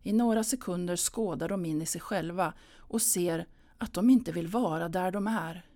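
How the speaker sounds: usual speaking voice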